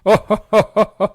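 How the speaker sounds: big, loud booming belly laugh; roaring laugh. Acted. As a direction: roar with laughter. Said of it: "William roared with laughter".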